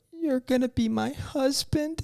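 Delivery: put-on high, sweet, childlike voice; little girl voice. Imitating his fiancée as a little girl, high and sweet. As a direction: squeak it up like a small girl declaring it. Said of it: "you looked up at me and you go".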